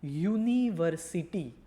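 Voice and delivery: University slow and loud, syllable by syllable; university drawn out in clear syllables; word presented slowly. Shown as a demonstration. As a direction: spell out university by ear, each syllable stretched. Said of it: "એક word".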